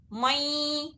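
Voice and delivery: مَیں with the noon hummed through the nose; two-beat ghunna. Shown as a demonstration. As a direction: hold the nasal in مَیں for two counts. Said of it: "دو حرکت غنہ بھی کرنا ہے".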